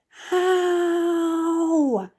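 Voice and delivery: high, loud pained scream. Acted. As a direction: shriek it out like the hat tore his skin. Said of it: "he screamed".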